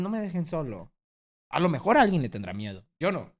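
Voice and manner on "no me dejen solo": sad plea. Pretend. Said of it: "es más bien".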